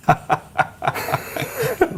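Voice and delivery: very high, delighted laugh; laughing at the cheeky punchline; real laugh. Real, not acted. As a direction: burst out laughing. Laughs at Hogan's line.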